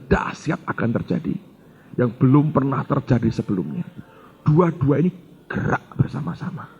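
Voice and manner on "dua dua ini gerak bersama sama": usual speaking voice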